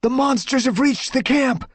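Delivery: high, loud warning cry; urgent shout. A narrator's reading. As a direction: shout the alarm.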